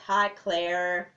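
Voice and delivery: put-on American accent with long R's. Shown as a demonstration. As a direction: stretch the R in "Claire" like an American. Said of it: "Americans stretch their R's a lot".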